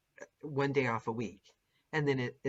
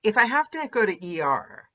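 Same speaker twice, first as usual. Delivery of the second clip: mock dedicated narrator; 4 semitones higher, 5 dB louder than usual. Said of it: "A narrator goes".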